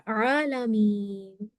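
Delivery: عالمین very slowly, with a throaty ع; careful recitation. Shown as a demonstration. Teacher recites عالمین slowly, showing the ع. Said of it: "عین سے word ہے".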